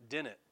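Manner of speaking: "didn't" nasal, the d's swallowed, like "dinnit"; Hoosier didn't. Shown as a demonstration. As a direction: say "didn't" as "di-nnit", no second d. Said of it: "Y'all say".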